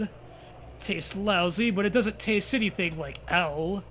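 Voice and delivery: ordinary talk, nothing marked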